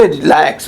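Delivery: रिलैक्स with RI squeezed short, weight on LAX; barely touch RI, land on LAX in रिलैक्स; correct English pronunciation. Shown as a demonstration. Teacher showing how good English speakers say it, r'LAX. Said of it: "English सही बोलने वाले होते हैं".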